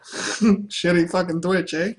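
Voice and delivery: slow, laughing jeer at Twitch; laughing taunt; laughing mock jeer. Real, not acted. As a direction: drag it out through laughter. Mocking Twitch admins while laughing.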